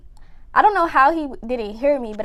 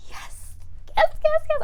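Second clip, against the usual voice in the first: excited yes; 3 dB quieter than usual. Retold or imitated. Password finally worked.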